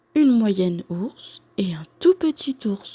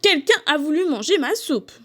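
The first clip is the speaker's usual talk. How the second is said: higher-pitched, mildly cross exclamation; lightly acted crossness, pitched up; cross mama bear; 6 semitones higher than usual. A narrator's reading. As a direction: complain as an annoyed mother bear. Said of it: "constate d'une voix fâchée".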